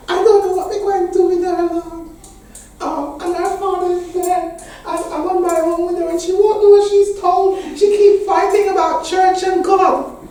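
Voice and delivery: very high, loud Jamaican mother's lament; Jamaican accent. Retold or imitated. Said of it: "that wonderful quintessential Jamaican accent".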